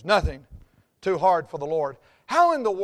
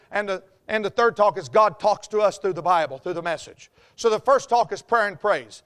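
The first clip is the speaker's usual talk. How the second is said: ordinary delivery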